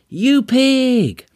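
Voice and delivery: high, stretched-out retort yell; acted indignant shout; shout back. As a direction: yell the insult back at a passing driver. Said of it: "and shouts back to him".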